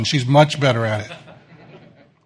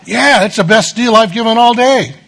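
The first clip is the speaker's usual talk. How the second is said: pushy vendor; 6 semitones higher, 4 dB louder than usual. Retold or imitated. Vendor voiced in story.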